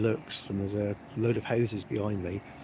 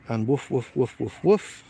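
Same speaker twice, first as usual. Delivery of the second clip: mock dog woofs; 4 dB louder, slower than usual. Imitating the dogs.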